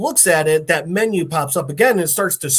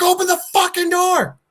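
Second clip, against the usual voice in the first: very high, strained mock shout; desperate yell; 13 semitones higher than usual. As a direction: act out frantic demanding at a locked door. Carl yells at the door.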